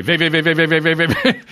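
high, loud rhythmic vey chant; rapid oi vey chant. Retold or imitated. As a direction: chant vey like a racing heartbeat. Mimicking arrhythmia rhythm, joke.

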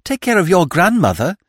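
girl mocks his warning, cheeky; cheeky retort. A narrator's reading. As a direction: throw the words back with cheek. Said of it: "retorted the girl".

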